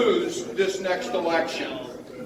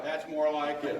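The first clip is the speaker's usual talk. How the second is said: normal talking voice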